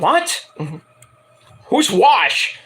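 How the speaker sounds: high, puzzled asking; confused fans. Retold or imitated. Fans puzzled by the singer's new nickname. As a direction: sound baffled by the new name. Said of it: "the fans are like".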